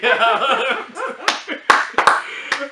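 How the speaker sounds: high, loud burst of laughter; loud cackle. Real, not acted. Host cracking up at the banter, loud high laugh.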